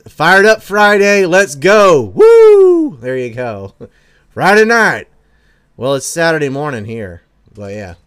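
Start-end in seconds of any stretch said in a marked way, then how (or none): 0.1-3.0 loud, high, hyped-up reading of the chat cheer. Retold or imitated. Streamer reading a fan's Friday hype, loud and high. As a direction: read it like a rally cry, building to a big woo. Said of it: "Free Mind Conspiracy says".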